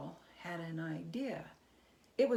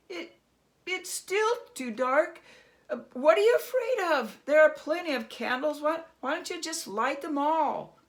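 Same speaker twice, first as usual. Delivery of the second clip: much higher, very loud, fast nervous goading; jittery Herschel; 8 semitones higher, 11 dB louder than usual. Acted. Herschel nervously goading the goblin to light all candles. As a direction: needle him, voice cracking with nerves.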